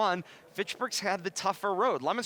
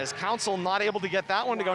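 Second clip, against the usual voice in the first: usual speaking voice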